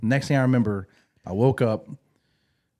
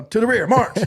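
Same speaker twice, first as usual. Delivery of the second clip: much higher, louder barked march order; drill command; 12 semitones higher, 7 dB louder than usual. Retold or imitated. A flustered corporal calling the squad to march, loud and high. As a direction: call it across a parade deck.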